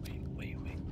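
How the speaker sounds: breathy whispered wait wait; whispering. Real, not acted. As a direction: whisper so the monster won't hear. Hiding from blind monster.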